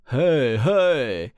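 loud calling hey hey; shouting. Acted. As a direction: call out loudly to the brothers ahead. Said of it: "笨汉汉斯嚷道". (大声嚷叫)

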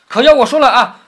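high, loud lead-up to his verse; loud announcement. Acted. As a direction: announce your turn loudly, pitched high. 薛蟠行令前高声宣布. (高声宣布)

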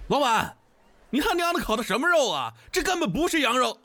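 cursing at the owner across the room; young man berates the owner, yelling; angry yell. A narrator's reading. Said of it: "大骂道".

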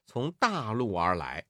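ordinary delivery

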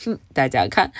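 usual speaking voice

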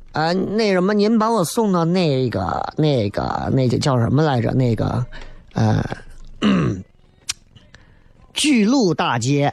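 put-on slow, hesitant Beijing accent. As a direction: drawl it like a lost Beijinger. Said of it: "咱咋一说都是北京话".

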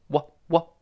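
usual speaking voice

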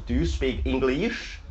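"English" as "ing-gleesh", rising steeply; wrong pronunciation. Shown as a demonstration. Teacher shows another mistake, "English" stretched and rising. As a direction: push "English" up with a long "ee". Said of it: "或者是".

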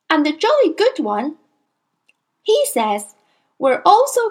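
put-on high child's voice; proud small boy. A narrator's reading. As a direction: answer back like a proud little boy. Boy defends father.

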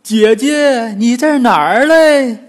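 high, loud pig-demon call; loud goofy pig demon calling his wife. Acted. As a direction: holler for the girl like a clumsy pig monster. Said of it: "嘴里喊".

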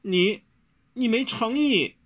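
high, heartbroken accusation; wounded, high-pitched reproach. A narrator's reading. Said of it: "温柔伤心欲绝地说".

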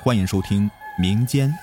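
hushed horror programme announcer; eerie show intro. Acted. Recorded-style ghost story programme opening.

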